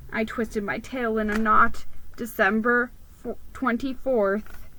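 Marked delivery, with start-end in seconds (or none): none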